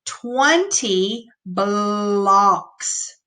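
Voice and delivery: very slow, stretched reading of "twenty blocks"; slow modeled reading; slow read-aloud. Shown as a demonstration. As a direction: draw the words out slowly. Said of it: "Let's read the next sentence".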